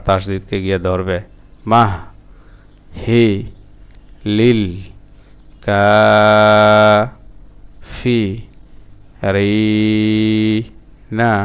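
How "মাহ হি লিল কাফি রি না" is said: মাহ, হি, লিল each alone, then long Kafi and রি; broken-up syllables. Shown as a demonstration. Teacher sounding out the word for learners, piece by piece. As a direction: chant slowly, stopping after each syllable. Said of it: "আবার ভাঙা ভাঙা উচ্চারণ".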